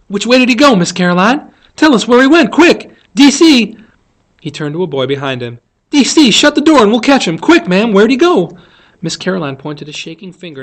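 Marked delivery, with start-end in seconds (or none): 0.1-3.8 high, loud, eager calling; rally everyone to the chase; urgent shouting. Acted. Boy urgently chases the creature. 5.9-8.6 high, loud, hurried orders; urgent calling. Acted. Boy calls out orders urgently.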